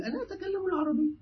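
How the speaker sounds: high, quick protesting student; mock student protest. Teacher voices a student insisting he speaks Arabic, high and quick. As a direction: play the stubborn student, voice up high. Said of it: "تقول".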